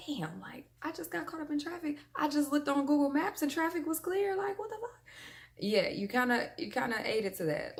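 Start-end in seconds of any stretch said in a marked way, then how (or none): 0.1-4.9 high-pitched put-on griping; exasperated mock complaint. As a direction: act out a baffled complaint, pitched way up. Acts out driver surprised by traffic.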